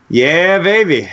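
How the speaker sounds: put-on high, loud "yeah, baby" whoop; a hammed-up little victory shout; mock triumphant cheer. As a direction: cheer it like a cheesy victory cry. Late-night work triumph joke.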